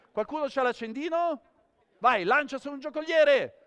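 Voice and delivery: high, loud showman's shout; loud performer's call. Real, not acted. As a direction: project the cue out to the crowd. Performer calling out during live show.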